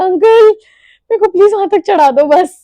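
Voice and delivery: loud, high, rapid plea; pleading cry for help. Retold or imitated. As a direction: call out desperately to a helper. Crying out in pain for help.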